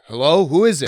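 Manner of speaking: loud, lifted hello to an unseen ghost; mock bold call-out. Comic showing his timid friend how to greet ghosts, mock bold call. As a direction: call out boldly to whoever is there. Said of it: "didn't have the courage to be like".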